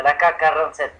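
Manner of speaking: ordinary talk, nothing marked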